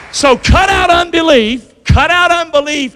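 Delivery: slow, punched preaching call; full-force preaching. Acted. Preacher urging the church to cut out unbelief, preaching hard. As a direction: drive the call home to the church.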